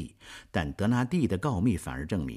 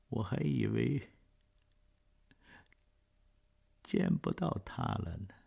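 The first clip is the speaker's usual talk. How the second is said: barely strength to speak; weak dying voice; 5 semitones lower, slower than usual. Acted. Dying old man speaks.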